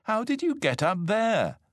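high-pitched amazed question; astonished. A narrator's reading. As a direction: ask it high, taken aback. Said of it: "gasped Elmer".

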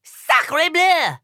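high French exclamation; screaming French. A narrator's reading. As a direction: shriek it like an outraged French diplomat. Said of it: "screams another diplomat".

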